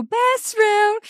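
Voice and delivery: high-pitched pretend cheer, no real volume; mock yell. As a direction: call it out high like a playful yell. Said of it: "Do you get the urge to yell".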